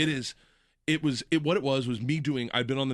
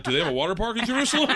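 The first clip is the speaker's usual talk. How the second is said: fast, higher, excited; eager tourist; 7 semitones higher, 4 dB louder, faster than usual. Retold or imitated. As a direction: rattle it off like a keen kid. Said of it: "most people are like".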